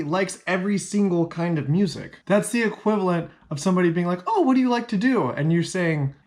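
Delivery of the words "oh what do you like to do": chirpy mock asker. Acting out a chirpy question.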